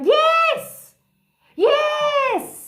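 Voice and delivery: high, loud drawn-out cheer; celebratory yes. Real, not acted. Host cheering on a live viewer, jubilant. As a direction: shout it high and hold it.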